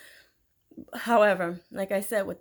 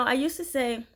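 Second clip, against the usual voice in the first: ordinary talk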